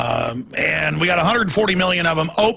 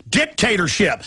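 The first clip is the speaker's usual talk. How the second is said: ordinary talk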